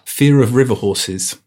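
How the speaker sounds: usual speaking voice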